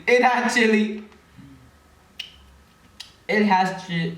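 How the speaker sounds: shocked. Real, not acted. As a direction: realize the candy is spicy. Discovering chili in candy.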